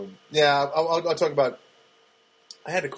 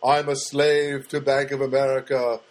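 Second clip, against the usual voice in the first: slow, louder mock-devoted agreement; a hammed-up slave vowing obedience to his master; mock servile; 4 dB louder than usual. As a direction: grovel to the bank in sarcastic surrender. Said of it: "And I started going".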